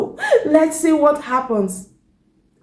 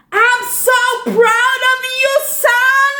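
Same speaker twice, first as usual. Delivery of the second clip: high, ringing call of a proud father; proud shout; 9 semitones higher than usual. Acted. Dad calling out to his son after the race, high proud shout. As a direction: call out your pride across the pool. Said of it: "Now I'm gonna shout just like that".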